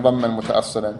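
normal talking voice

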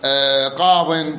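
"قاض" بضاد ساكنة وحذف الياء، ببطء; قفْ على "قاض" بلا ياء ببطء; وقف بالحذف. Shown as a demonstration. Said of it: "تقول ماذا". (الوقف بحذف الياء)

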